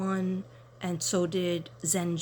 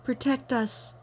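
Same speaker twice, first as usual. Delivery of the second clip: excited delight; 7 semitones higher than usual. Real, not acted. Showing viewers the Saint Michael pendant, excited gushing. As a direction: gush over the pendant.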